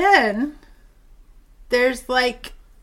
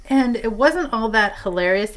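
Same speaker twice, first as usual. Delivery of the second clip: normal talking voice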